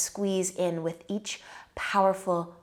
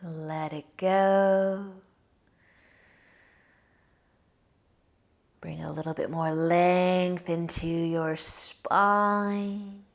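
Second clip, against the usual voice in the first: guided meditation; 4 dB quieter than usual. Acted.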